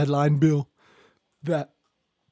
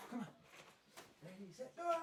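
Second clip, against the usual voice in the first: usual speaking voice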